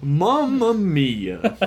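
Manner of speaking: put-on high, very drawn-out Italian accent; hammed-up, slow Italian accent; mock Italian accent. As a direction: stretch it into a cartoon Italian exclamation. Echoes the joking accent bit.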